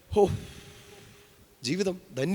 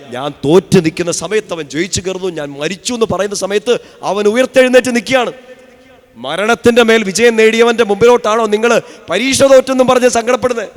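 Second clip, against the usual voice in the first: higher and much louder fervent preaching; preaching at full force; 5 semitones higher, 8 dB louder than usual. Acted. Preacher scolding the congregation for grieving over exams, full-force preaching. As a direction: thunder it at the flock.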